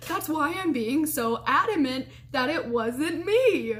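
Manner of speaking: slow, drawn-out insistence, imitating the roommate; adamant. Roommate denying she was in there, adamant insistence. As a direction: stretch it out, mildly hammed-up and adamant. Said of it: "she just goes from this to".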